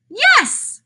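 high, exclaimed yes; excited yes. Acted. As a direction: pop the yes up high with delight. Playful answer to silly question.

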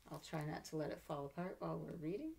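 usual speaking voice

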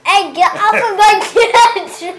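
shouting. Real, not acted. Shouting at player on head.

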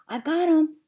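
high, loud shout. A narrator's reading. Sweetie Pie shouts that she saved them, loud. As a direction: call it out proudly. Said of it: "she shouted".

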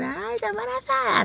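high, hushed mock squeal; gushing busybody. Retelling the tactless pregnancy question. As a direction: lightly squeal the blunder. Mock gaffe quote again.

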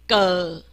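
g as a long, sinking "guh"; 字母发音示范. Shown as a demonstration. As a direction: draw out g with its vowel, falling. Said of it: "我们念g". (拉长念g，音调下降)

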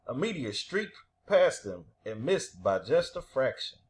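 ordinary delivery

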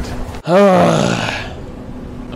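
long heavy exhale; exasperated sigh. Real, not acted. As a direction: let out a long, fed-up sigh. Exasperated after dying in game.

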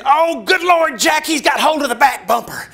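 high loud alarmed wife; high frantic cry; panicked yell. Retold or imitated. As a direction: scream the news in alarm. Wife yells cat grabbed bumper.

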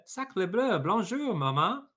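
high, loud mock French duckling; a hammed-up French baby duck. A narrator's reading. Duckling greets Jack as mama, high and loud. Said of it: "who looked up at Jack and said".

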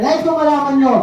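booming high announcement; royal proclamation. Retold or imitated. King's best friend addressing the kingdom, booming call. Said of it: "Sabi niya".

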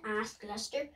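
usual speaking voice

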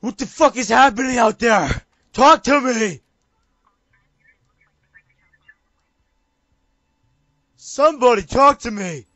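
high, loud calls for teammates; real frantic calling; urgent shouting. Real, not acted. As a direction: demand an answer over comms.

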